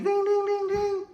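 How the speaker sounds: very high, rapid dings; imitated bell ringing. Storyteller voices the bell ending round one, high dings. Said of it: "The bell fucking rings".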